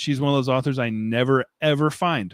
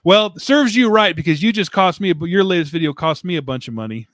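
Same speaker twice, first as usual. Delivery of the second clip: higher and louder, playful told-you-so; mock scolding; 5 semitones higher, 6 dB louder than usual. Teasing a viewer whose video cost him money, in mock reproach.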